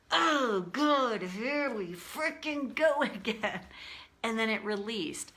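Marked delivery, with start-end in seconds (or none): 0.1-3.6 retold exasperation. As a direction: groan it slowly, acting out being fed up. Venting exasperation in a retold moment.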